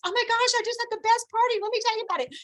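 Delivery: high-pitched, gushing imitation of a friend; excited. Girlfriend calling about her best party, excited. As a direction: gush as if bursting with party news. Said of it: "we all get excited".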